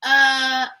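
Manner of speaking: plain reading voice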